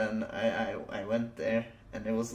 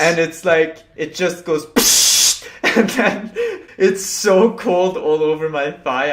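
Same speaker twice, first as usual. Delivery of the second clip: laughing; 6 semitones higher, 11 dB louder than usual. Real, not acted. Laughing while telling the cold blast.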